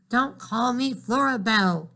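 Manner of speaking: plain reading voice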